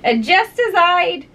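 high-pitched, exasperated bird voice; plaintive pigeon listing grievances; fretful pigeon. Acted. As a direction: complain like a harried mother bird.

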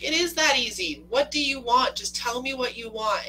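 high, loud, insistent comeback; Noah. Retold or imitated. Noah pressing the woman to choose, heated insistence. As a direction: push back hard, imitating a heated movie lover. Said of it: "He's like".

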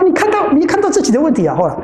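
usual speaking voice

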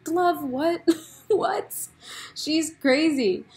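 high, incredulous half-laughed "what"; laughing disbelief. Retold or imitated. Viewer reacting to Love's claims, laughing in disbelief. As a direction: react in amused, high-pitched bafflement.

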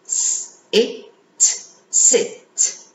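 s and it apart, then joined into "sit"; sounding out then blending. Shown as a demonstration. Teacher modelling sound blending, then joining into a word. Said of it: "Did you hear the I sound".

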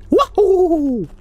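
high-pitched "wahoo" holler; long high gleeful whoop. Real, not acted. Whoops while fleeing.

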